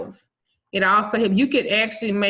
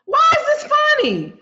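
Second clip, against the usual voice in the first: much higher, loud mock outrage; shouting in disbelief; 5 dB louder than usual. Real, not acted. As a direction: yell it out, amused but outraged. Outburst at laughing guest.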